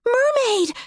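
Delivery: high loud excited yell. A narrator's reading. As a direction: yell it out with joy.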